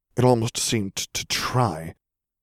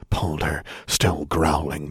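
ordinary delivery

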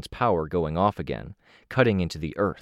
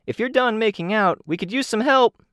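high, loud call across a fight; 4 dB louder than usual. A narrator's reading. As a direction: shout it over to the others, high. Teammate calls out across fight.

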